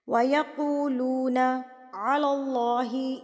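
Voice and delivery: slow, drawn-out tajweed recitation; measured Quranic recitation. Acted. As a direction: chant it slowly and evenly. Recites verse.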